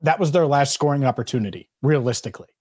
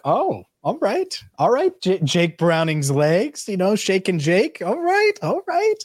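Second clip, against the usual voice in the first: rising, delighted high all rights; gleeful, climbing pitch; excited; 5 semitones higher, slower than usual. Retold or imitated. Retold own excited reaction.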